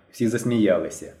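ordinary delivery, nothing marked

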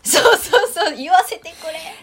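high, loud campaign shout; rally speech. Pretend. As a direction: proclaim it from a sound truck. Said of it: "選挙カーに乗って". (選挙カーからの演説口調)

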